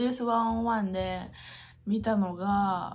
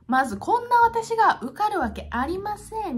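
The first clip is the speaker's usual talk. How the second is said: dramatic declaration; 10 semitones higher, 5 dB louder than usual. Acted. Mock self-deprecating declaration.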